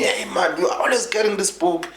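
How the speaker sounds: high-pitched mock shout of an exasperated mother; high, nagging retold scolding. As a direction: imitate a mum telling off her child. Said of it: "used to shout at me".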